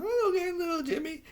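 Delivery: hammed-up parent sweet-talking little Jimmy; mock parent voice. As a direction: play a fake-cheerful parent lying to their child. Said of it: "they're like".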